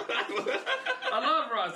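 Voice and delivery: high, stretched-out, laughing gush; mock gushing. Comedian gushing about Russell to his cohost, high and drawn out. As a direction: swoon over the name, dragging it out.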